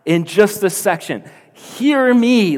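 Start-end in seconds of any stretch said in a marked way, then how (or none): 1.5-2.6 high, loud plea to listen; forceful preacher's cry; urgent appeal. Retold or imitated. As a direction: call out as Jesus, demanding attention. Voicing Jesus demanding to be heard.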